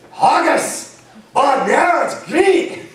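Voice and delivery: high, exaggerated Scottish accent; Scottish accent catchphrase. Shown as a demonstration. Voice actor demonstrating a line to find the accent, exaggerated Scottish. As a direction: belt out a cartoon Scottish accent that slips astray. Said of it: "my receding line is haggis".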